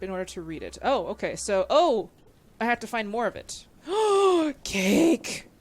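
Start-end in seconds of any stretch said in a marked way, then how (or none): 3.8-4.6 a loud high gasp of delight; sharp delighted gasp. Real, not acted. Streamer gasps loudly at a cake in the game. 4.6-5.5 excited cry. Real, not acted. Spots a cake in the game.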